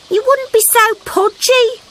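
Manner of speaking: put-on high cheeky kid-frog remark; little frog voice. A narrator's reading. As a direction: tease your mum. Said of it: "he said".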